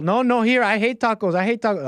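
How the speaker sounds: high, insistent mock denial; panicky hiding woman. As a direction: play frantic denial in a comic high voice. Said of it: "she says she doesn't want tacos anymore".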